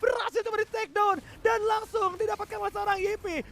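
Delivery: high loud play-by-play; excited casting. Real, not acted. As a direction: ride the fight call up high. Caster calls Lord fight. (komentator heboh saat perebutan Lord)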